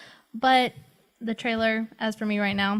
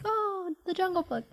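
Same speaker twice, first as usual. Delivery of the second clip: nostalgic; 8 semitones higher, 8 dB quieter than usual. Retold or imitated. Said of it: "I was like".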